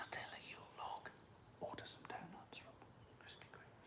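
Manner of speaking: secret whisper back. Real, not acted. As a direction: breathe it, no voice at all. Whispering back so daughter won't hear.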